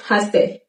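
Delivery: haste as one word; quiet merged haste; reduced form. Shown as a demonstration. Teacher shows the spoken haste.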